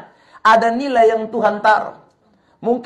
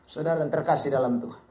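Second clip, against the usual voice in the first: ordinary talk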